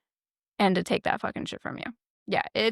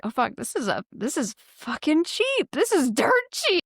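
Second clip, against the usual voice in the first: delighted tourist; 7 semitones higher than usual. Retold or imitated. Tourist amazed at a cheap foreign bill, rising glee. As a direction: squeal over a bargain. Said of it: "So you're thinking".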